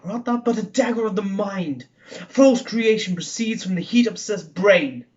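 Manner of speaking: theatrical Macbeth soliloquy, Scottish-tinged; haunted murderer wrestling with a vision of a dagger; dramatic verse recitation, rising to bursts of force; Shakespeare monologue. Acted.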